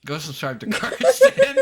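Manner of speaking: high, loud laughing yell; laughing shout. Real, not acted. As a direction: yell the plug while laughing.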